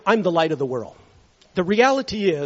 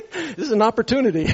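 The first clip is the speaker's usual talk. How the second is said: talking through a laugh; slightly raised pitch, chuckling around the words; amused laughter; 5 semitones higher, 3 dB louder than usual. Real, not acted. Amused at his own story.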